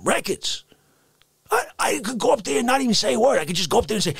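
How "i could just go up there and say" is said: normal talking voice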